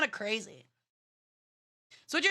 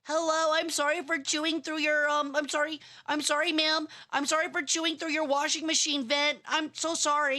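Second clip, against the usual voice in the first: high, apologetic little rat squeak; timid little rat voice; 5 semitones higher than usual. Retold or imitated. Streamer voicing a cute rat apologising to the homeowner, high and timid. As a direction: play the sorry rat peeking round the corner, pitched up. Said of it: "He's just like".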